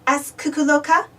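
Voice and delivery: usual speaking voice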